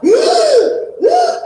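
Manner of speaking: high, loud gasp for air; sharp gasp. Retold or imitated. Pinned by the paddle strap, she gasps for air. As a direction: gasp as if choking underwater. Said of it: "and I'm like".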